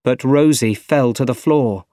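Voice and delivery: ordinary delivery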